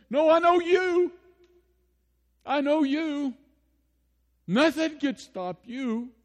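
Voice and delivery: high, loud fervent declaration slowing at the end; fervent cry. Acted. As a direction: shout Martha's faith out in full passion. Martha's answer, peak of sermon.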